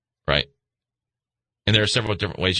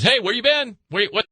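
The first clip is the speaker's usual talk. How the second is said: voicing God; 10 semitones higher than usual. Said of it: "God says".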